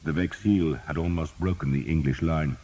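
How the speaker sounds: plain reading voice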